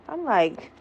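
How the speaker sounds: normal talking voice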